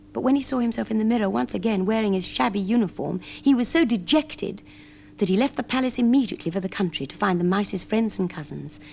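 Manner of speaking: ordinary delivery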